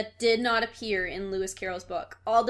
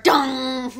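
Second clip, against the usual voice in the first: imitated dong sound; 4 semitones higher, 8 dB louder than usual.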